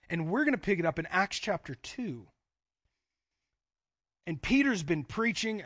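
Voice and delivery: usual speaking voice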